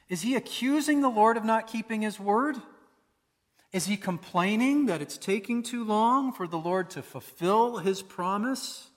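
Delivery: ordinary talk, nothing marked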